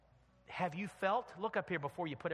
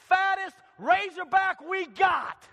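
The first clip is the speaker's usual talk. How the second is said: slow shouted order; 16 dB louder, slower than usual. Acted. Father orders the feast.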